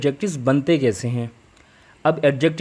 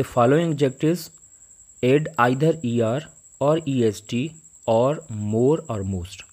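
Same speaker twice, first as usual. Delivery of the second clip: each word laid out slowly for the class; slow dictation; much slower than usual. Shown as a demonstration. Teacher dictating a grammar note to students, slow and spaced. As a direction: read the note slowly so students can copy it. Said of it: "teacher dictates the note slowly".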